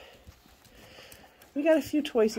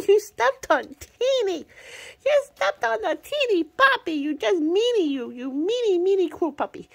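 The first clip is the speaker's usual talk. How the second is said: mock scolding; 8 semitones higher than usual. Owner teasing puppy that stepped on Teeny, mock scold. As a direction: tease the puppy as a meanie.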